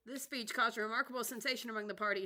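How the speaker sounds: plain reading voice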